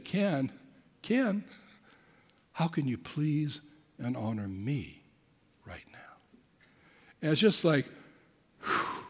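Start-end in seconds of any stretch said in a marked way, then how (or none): 0.0-6.2 soft, slow, fading to a breath at the end; gentle voice of God. Retold or imitated. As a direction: speak as a patient inner voice. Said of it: "He said".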